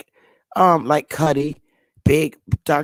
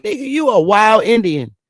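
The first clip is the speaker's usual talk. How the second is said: higher-pitched, animated outburst; retold amazed exclamation; 6 semitones higher, 4 dB louder than usual. Teller reacting to the man saying Georgia, retold astonishment. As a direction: exclaim it in amused disbelief, as a retelling.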